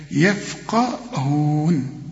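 very slow يفقهون with the fa held soft; correct reading. Shown as a demonstration. Teacher gives the right form, very slow. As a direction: draw out يفقهون. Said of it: "ولا يف".

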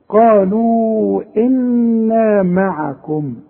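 قالوا إنا معكم recited slowly with nasal ghunna on إنا; slow chanted Quran reading; tajweed recitation. Shown as a demonstration. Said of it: "شوف معكم دي".

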